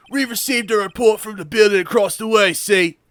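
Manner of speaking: hammed-up Scotland Yard constable; bad British constable accent. Detective reporting a call, botched British constable accent. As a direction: report like a British bobby, badly. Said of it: "Sound like a constable from Scotland Yard".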